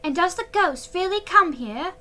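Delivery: put-on high, eager young girl asking; high girlish question. A narrator's reading. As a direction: lift it like a curious girl. Dancer asks, high voice.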